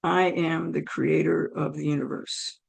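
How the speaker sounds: low, soft, measured voice of God; imitating God, calm and deep; God's voice. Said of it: "And I hear".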